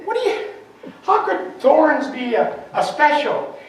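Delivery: high, laughing, incredulous question; amused disbelief, drawn out. Retold or imitated. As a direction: ask it through a laugh, baffled. Retold baffled question at the florist.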